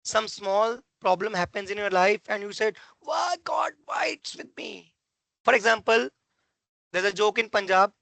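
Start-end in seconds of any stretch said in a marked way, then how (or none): 3.0-5.0 high, drawn-out mock wail; hammed-up plea to heaven, slow and high; mock lament. Person lamenting a small problem in life, mock despairing.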